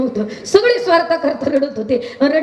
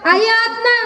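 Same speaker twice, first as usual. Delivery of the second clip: raised, ringing sermon voice; preaching sadhu; 6 semitones higher than usual. Acted. Sadhu urges the mother to drink, in a preaching voice. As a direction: extol her as a mother to win her over.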